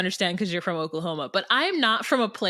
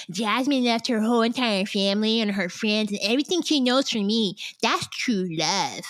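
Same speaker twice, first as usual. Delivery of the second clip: lightly voiced Gino, slowing down at the end; a hint of a dreamy husband; imitating Gino. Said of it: "and Gino's like".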